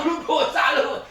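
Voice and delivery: teasing line spoken through laughter; laughing mock rebuke; laughing friend's jab. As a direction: rib a friend while laughing. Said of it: "dia ngomong".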